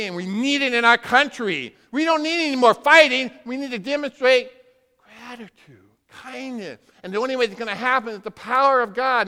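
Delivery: rising preacher's shout; preaching climax. Real, not acted. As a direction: cry it out to the congregation.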